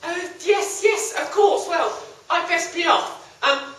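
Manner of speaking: high flustered voice; flustered squeak. Acted. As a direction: squeak it nervously. Hero hurries away.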